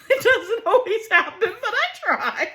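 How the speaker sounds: laughing at herself, high and loud; giggling self-mockery. Real, not acted.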